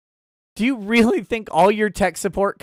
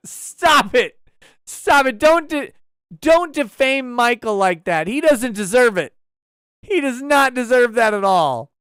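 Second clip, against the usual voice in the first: higher, louder mock outrage; mock scolding; 5 semitones higher, 5 dB louder than usual. Streamer defending Michael from the chat, mock-indignant scolding. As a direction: tell them off in mock indignation.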